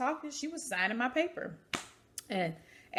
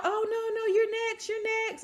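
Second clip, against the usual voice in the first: imitating the nurse, pitched up high; 14 semitones higher than usual. The nurse reassures the waiting patient, imitated high. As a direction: reassure her quickly in a high voice. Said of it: "And she was like".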